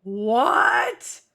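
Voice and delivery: a loud, stretched cry of disbelief; shocked what. Real, not acted. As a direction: yelp it like you just saw a ghost. Shocked by the ghost child.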